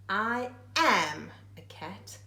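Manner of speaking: normal talking voice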